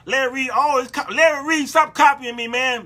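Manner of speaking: high, loud mock complaint; mock annoyance. Host telling off a rival for copying, mock-annoyed.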